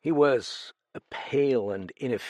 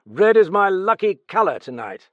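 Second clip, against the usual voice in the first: higher, louder excited cry; cried out; 9 semitones higher, 11 dB louder than usual. Acted. Raoul at the roulette table, crying out excitedly. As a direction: call it out like a lucky gambler. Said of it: "he cried".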